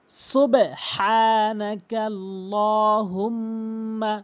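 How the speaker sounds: very slow, drawn-out Arabic recitation; melodic recitation. Shown as a demonstration. Teacher reciting the Sana for learners, slow melodic recitation. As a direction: stretch the long vowels and hold the humming m. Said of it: "সুন্দর করে সুর দিয়ে reading".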